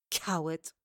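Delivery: low, contemptuous single word; spat insult. A narrator's reading. Accusing someone of hiding, a low contemptuous insult. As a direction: spit out the insult, low and restrained. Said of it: "She spat this last insult".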